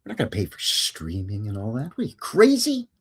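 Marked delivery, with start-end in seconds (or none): 2.0-2.9 mock incredulous.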